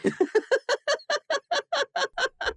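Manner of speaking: high breathy burst of laughter. Acted. Albatross laughing at Chuya, high burst of laughter. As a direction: laugh high and hard at him. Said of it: "Albatross is laughing at him".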